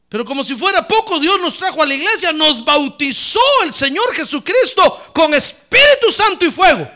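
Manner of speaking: loud, rising sermon cry over the church; preaching at full force. Acted. Pastor preaching to the congregation, loud and fervent. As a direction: proclaim it to the whole church. Preacher exalts the Spirit's fire.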